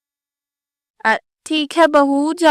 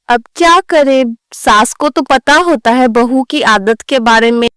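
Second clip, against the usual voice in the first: ordinary talk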